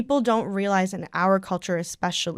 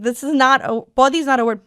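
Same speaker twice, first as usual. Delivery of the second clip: loud, high, exasperated insistence; a half-mock exasperated correction; indignant; 5 semitones higher, 9 dB louder than usual. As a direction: set them straight, fed up. Indignant retort in story.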